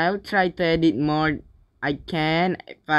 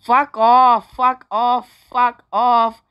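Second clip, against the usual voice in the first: raised, loud repeated outburst; frustrated shouting; 4 semitones higher, 6 dB louder, slower than usual. Real, not acted. Player under attack in the game, yelling. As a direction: yell each one in annoyance.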